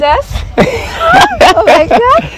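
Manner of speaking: sudden shriek of surprise at the gift; shocked shout. Real, not acted. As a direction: cry out in disbelief, half laughing.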